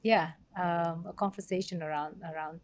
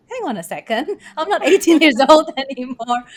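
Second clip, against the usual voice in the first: amused inner voice, higher and louder with a laugh; mock exasperated, laughing; laughing self-quote; 7 semitones higher, 14 dB louder, faster than usual. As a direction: tell yourself off while giggling. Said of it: "and then I'm like".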